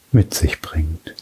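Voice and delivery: trailing hushed whisper; soft spa whisper. Acted. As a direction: let it fade into a whisper. Whispered end of phrase.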